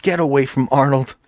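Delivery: high, hammed-up cry of alarm; mock frantic woman. Woman running up to save the head, hammed-up alarm. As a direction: overact her panic at the daughter.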